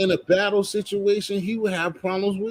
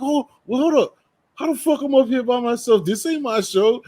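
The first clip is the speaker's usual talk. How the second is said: high-pitched mock protest; high, put-on voice of a baffled friend; imitating friend's protest; 8 semitones higher, 3 dB louder than usual. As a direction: play him caught alone on the show, voice raised high. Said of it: "He's like".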